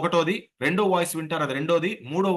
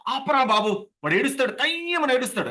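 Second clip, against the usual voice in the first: mock exasperated parent; 7 semitones higher than usual. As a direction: beg the crying child to stop, acting fed up. (భరించలేని తల్లిదండ్రుల నటన)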